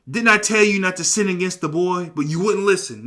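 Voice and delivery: loud scolding. Acted. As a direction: read it as a forceful I-told-you-so. Reuben scolding his brothers, read aloud.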